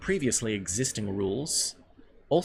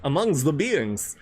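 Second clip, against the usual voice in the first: slow, loud, campy Dracula accent; hammed-up vampire accent, higher and drawn out; 5 semitones higher, 5 dB louder, slower than usual. Shown as a demonstration. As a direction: overdo a Slavic Dracula accent.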